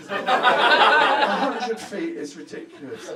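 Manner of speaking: slow, loud, laughing through it; incredulous laughing drawl; laughing disbelief. Real, not acted. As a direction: drag it out while cracking up. Laughing disbelief at crowd answer.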